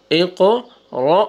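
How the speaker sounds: normal talking voice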